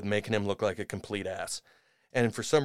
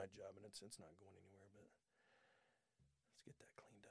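breathy aside under the breath; whisper; 3 semitones lower, 35 dB quieter than usual. Real, not acted. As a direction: whisper it like a secret to the room.

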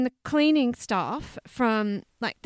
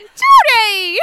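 loud, high cry of protest; scandalised shout; shocked exclamation; 11 semitones higher, 16 dB louder than usual. Real, not acted. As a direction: yell the name in laughing shock. Reacting to co-host's mock rat bashing.

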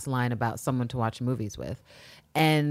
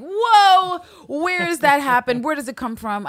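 high, loud excited whoa; excited gasp; 11 semitones higher, 8 dB louder, slower than usual. Retold or imitated. Fan reacting to the voiceover, high and loud. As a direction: shriek it out like a thrilled fan. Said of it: "And I was just like".

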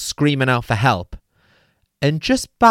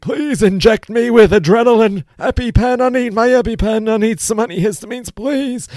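high, louder retold begging; desperate plea; 12 semitones higher, 4 dB louder than usual. Squatting by the motorway, he begs paramedics for adrenaline, high and urgent. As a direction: beg the paramedics, pitched way up.

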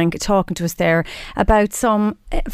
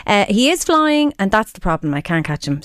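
normal talking voice

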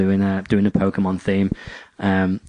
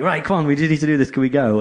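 impatient urging; 6 semitones higher, faster than usual. Retold or imitated. Impatient urging while hauling camera bags.

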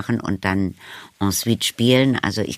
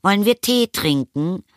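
high-pitched call to tea; lilting, raised motherly summons; 10 semitones higher than usual. A narrator's reading. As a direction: call it out to someone in the next room. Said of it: "rief die Mutter".

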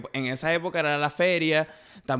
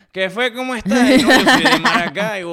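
high, loud cartoon Maracaibo accent; over-the-top Maracucho greeting; exaggerated Maracucho accent; 8 semitones higher, 9 dB louder than usual. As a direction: ham up the Zulia accent. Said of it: "me perturba escuchar a los maracuchos hablar así".

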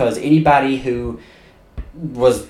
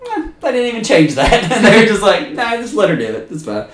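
lazy writers; 10 semitones higher, 4 dB louder than usual. Retold or imitated. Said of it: "you're like".

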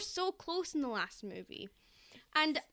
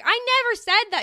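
indignant protest; 9 semitones higher, 12 dB louder than usual. Retold or imitated. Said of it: "Alina's like".

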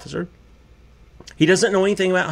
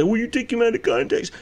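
mocking critics; 7 semitones higher than usual. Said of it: "And people say".

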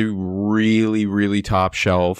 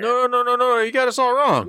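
acted protest. Player protesting to Johnny that he got them wrong, high-pitched. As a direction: plead innocence in a much higher voice.

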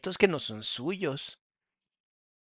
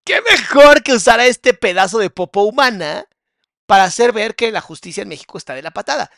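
much louder, pitch pushed up, ranting; angry rant; 3 semitones higher, 11 dB louder than usual. Real, not acted. Host rants at viewers about the justice system, shouting. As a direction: blast it out in outrage.

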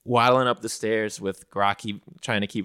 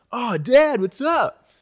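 put-on much higher, casual kid voice; Nelgork voice; 6 dB louder, slower than usual. Nelgork greeting his dad, much higher and casual. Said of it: "Nelgork's like".

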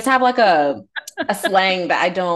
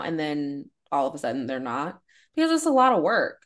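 normal talking voice

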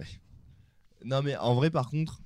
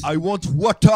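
loud mock British accent, pitched up; English accent; 6 semitones higher, 11 dB louder than usual. Co-host joining the bit, exaggerated English accent. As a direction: caricature British English at full volume. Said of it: "avec l'accent anglais".

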